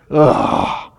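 loud, sharp groan of disgust. Retold or imitated. Pastor reliving beer thrown at him, groaning. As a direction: grunt as if hit by the beer.

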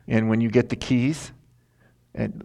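ordinary delivery

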